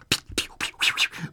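quick imitated "pew" zaps; playful flying-past sound effect; darting star noises. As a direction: fire off little whooshing pews. Imitates stars flashing past.

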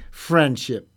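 ordinary delivery

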